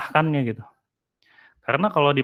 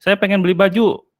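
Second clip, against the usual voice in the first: insistent husband; 7 semitones higher, 7 dB louder than usual. Acted. Husband insists on buying clothes.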